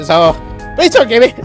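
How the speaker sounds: ordinary talk, nothing marked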